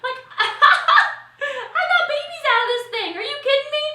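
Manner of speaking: giddy laughing disbelief; laughing delight. Real, not acted. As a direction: laugh out the joy of it. Laughing, thrilled about her friends.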